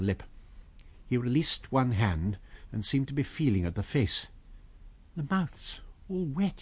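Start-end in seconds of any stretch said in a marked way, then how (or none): 5.2-6.6 soft, slow, uneasy words; hushed. A narrator's reading. Kemp feeling the face, hushed and uneasy. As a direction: murmur as you discover it. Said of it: "he said".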